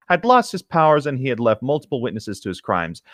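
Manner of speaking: ordinary delivery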